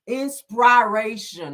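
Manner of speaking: "Inspiration" drawn out slowly, sounded out; mock thick tongue. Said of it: "She sound like her mama".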